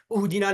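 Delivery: उहदीना begun with a short "u" vowel; initial short u. Shown as a demonstration. Teacher shows what not to read, उहदीना with initial "u". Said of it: "नहीं पढ़ेंगे".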